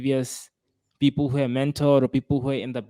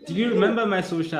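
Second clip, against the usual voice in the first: laughing; 8 semitones higher, faster than usual. Real, not acted. Teasing about old phone network.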